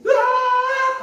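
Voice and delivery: loud high-pitched acted shriek; sudden scream. Master's wife crying out against Joseph, sudden scream. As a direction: shriek out suddenly to raise the alarm. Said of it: "갑자기 자기가 소리를 지르는 거예요".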